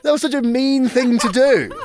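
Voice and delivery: laughing through the words; giggling protest. Real, not acted. Laughing after the painful sting.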